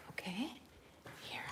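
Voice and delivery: real whisper while filming the horses; soft unvoiced whisper outside. Real, not acted. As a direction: hush it so the horses aren't disturbed.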